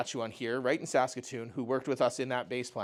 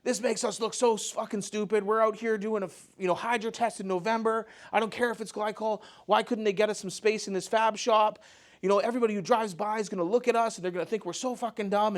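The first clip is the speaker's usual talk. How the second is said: high-pitched agitated rant, imitating a hothead coworker; freaking out; 8 semitones higher than usual. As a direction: rant in a worked-up, raised pitch. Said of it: "he just starts freaking out".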